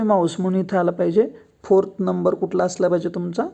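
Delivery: ordinary talk, nothing marked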